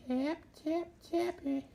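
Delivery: high, soft playful tap-tap; sing-song it in a tiny voice; squeaky high tapping. Real, not acted.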